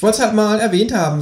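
high-pitched kangaroo, matter-of-fact. Acted. Kangaroo confesses to the narrator, high creature voice. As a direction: announce it high, as the talking kangaroo. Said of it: "sagt das Känguru".

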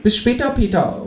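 high called farewell; calling kangaroo. Acted. Kangaroo calls from the door, high. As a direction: call it from the doorway. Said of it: "ruft das Teutelbier".

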